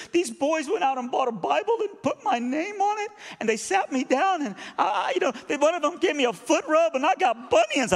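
imitated high, loud tearful gushing; emotional. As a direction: plead through it, overwrought and high. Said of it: "so sorry to be emotional".

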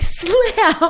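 loud mock door slam; high, loud playful slam; door slam noise. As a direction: shout it like a slamming door. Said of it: "I'm like".

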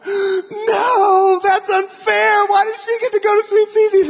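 high, loud wail imitating bawling kids; exaggerated whiny child's tantrum cry, drawn out; mock bawling. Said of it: "My sons started bawling".

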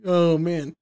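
usual speaking voice

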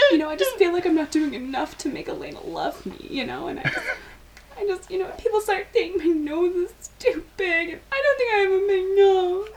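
high, mock whiny and teary; whiny confession. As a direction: play it pitiful and teary. Ceausescu confessing insecurities.

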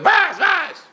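high-pitched mock yell; imitated screaming. Retelling a rabbi ruling on a question, imitated screaming. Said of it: "Moshe Big screamed all the time".

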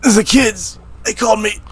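high-pitched, punchy character bit; mock Mister Glass voice. Comic riffing as Mister Glass explaining the nickname, high mock voice. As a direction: put on a high, pleading voice.

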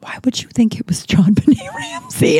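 unvoiced whisper with a laugh; a hushed whisper breaking into a laugh. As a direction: whisper it in disbelief. Friend whispers in disbelief.

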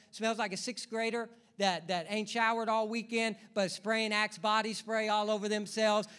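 ordinary delivery

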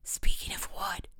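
teasing whisper to a friend; hushed, breath-only aside. A narrator's reading. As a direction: whisper it from a hiding place. Hidden friend whispers a joke.

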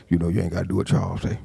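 low quick aside of a troublemaker; low soft fast mocking aside; sly gossip. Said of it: "somebody be like".

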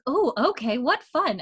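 high-pitched party-guest enthusiasm; mock delight. Dinner guests answering Bree's game, retold with mock delight. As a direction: chirp it like guests playing along.

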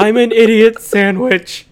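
high, loud, slow mock whimpering contestant; meme quote. Joking about a cooking show, mock idiot-sandwich voice. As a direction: squeak it out like a humiliated cook.

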